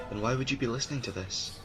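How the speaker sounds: ordinary delivery, nothing marked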